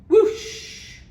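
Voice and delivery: loud, breath-filled whoosh; whooshing water. A narrator's reading. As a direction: imitate a rush of gushing water. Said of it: "Went the gurgly water".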